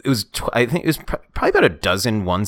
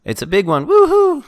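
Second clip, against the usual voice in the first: flat lines, then a high whoop; deadpan, then cheer woohoo; bad acting; 5 semitones higher, 3 dB louder than usual. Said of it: "He did that".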